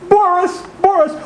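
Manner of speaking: high delighted call to the dog; gleeful calling. Acted. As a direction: call the puppy with joy.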